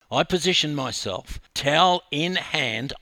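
plain reading voice

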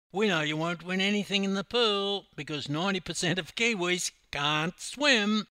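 loud, high, cocky bragging voice; loud cocky larrikin bragging; brash Aussie boast. A narrator's reading. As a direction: swagger up to the officer and announce it. Hammer taunting officer.

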